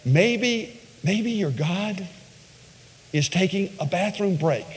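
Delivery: high, softened mock suggestion; sly trash talk. Elijah needling Baal's prophets about their god, sly mockery. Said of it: "he starts trash talking them".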